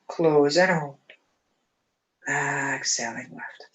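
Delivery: calm, even breathing instructor; guided breathing cues. Acted. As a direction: count the breath steadily and calmly. Leads alternate nostril breathing.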